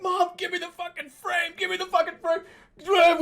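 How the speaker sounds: high, frantic retold yell; freaked out. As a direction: shriek for it in a total panic. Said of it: "I freaked the fuck out".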